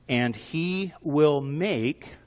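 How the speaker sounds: "he", "will", "make" each stretched out slowly; slow right down and lean on "will"; stress on will. Shown as a demonstration. Preacher reading Proverbs to the congregation, drawn-out stress on "will". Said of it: "I want to emphasize the word will".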